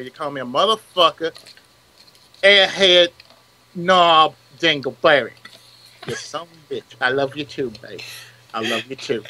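a hint of a wounded tough guy. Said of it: "I had to do this in the voice".

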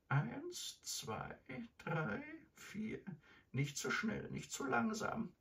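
put-on very high, small old-lady voice; timid counting grandmother. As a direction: count out carefully in a thin high voice. Grandmother obediently counts, frightened.

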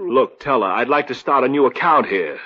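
loud insistence. Acted. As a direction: speak up firmly to the teller. Insisting at the bank window.

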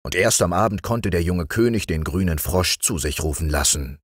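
ordinary delivery, nothing marked

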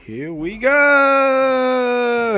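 very slow and high; drawn out. Pretend. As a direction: stretch it out long.

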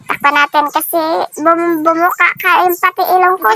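talking through chewed food; speaking behind hand over mouth; mouth full while talking. Real, not acted. Seen 1: Hands cover mouth while eating; balut shell in hand at 1.8.

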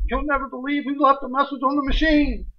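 high, excited voice of the wife; wife breathlessly bursting with news. Retold or imitated. Wife telling husband about a message, high and excited. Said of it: "Georgie, she's all ecstatic".

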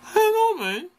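a squeaky shrug of a reply; mock clueless reply. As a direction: play dumb in a silly high voice. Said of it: "I'm like".

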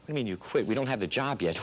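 normal talking voice

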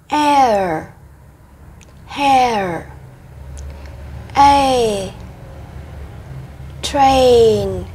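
each glide stretched clearly, sound then example word; diphthong drill. Shown as a demonstration. Teacher modelling English diphthongs for kids, slow and clear. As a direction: say "Ear" gliding into "here", slow and deliberate. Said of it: "Nguyên âm đôi".

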